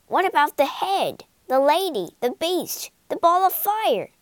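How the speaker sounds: high, loud and fast outcry; crying out together. Acted. Friends cry out at the old man about his tricks, rising outcry. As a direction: rattle off the list in outrage. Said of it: "cried the friends".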